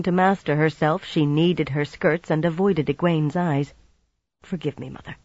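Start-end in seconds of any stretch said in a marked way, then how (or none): 4.4-5.2 soft, reluctant apology; grudging apology. A narrator's reading. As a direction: apologize through pride. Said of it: "She almost sounded as if she meant it".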